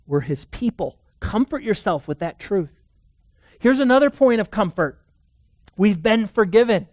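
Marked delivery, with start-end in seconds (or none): 3.6-6.9 rising, louder pulpit voice, building; fervent preaching. Acted. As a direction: preach it out with growing fire. Preaching at full force.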